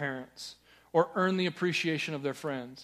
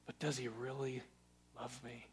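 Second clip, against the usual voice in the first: much softer, hushed and unsure; doubting inner voice; 5 semitones lower, 15 dB quieter than usual. Retold or imitated. Believer admitting the gut doubt to himself, hushed and unsure. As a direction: let the question sink to a private doubt. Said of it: "the reality deeper down that you have to wrestle with".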